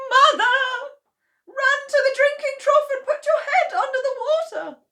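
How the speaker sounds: high, loud, tearful shriek of a panicking daughter. Acted. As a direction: scream her mother's name high and loud. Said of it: "shrieked Missus Cranky".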